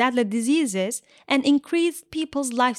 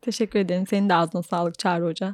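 tired voice; 6 semitones lower, faster than usual. Real, not acted. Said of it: "Biraz sesim yorgun gelebilir artık".

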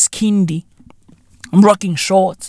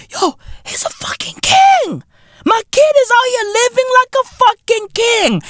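high excited whisper; 14 semitones higher than usual. Real, not acted.

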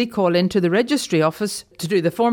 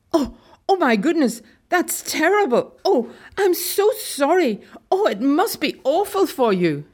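dismayed sympathy; 7 semitones higher than usual. A narrator's reading. Shocked clerk pitying a mother at the registry, dismayed sympathy.

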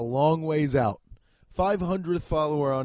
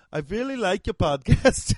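higher-pitched mock Belgian accent; bad Belgian accent; 3 semitones higher, 5 dB louder than usual. Imagined Belgian listener emailing the podcast, bad Belgian accent. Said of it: "I can't even do that fucking accent".

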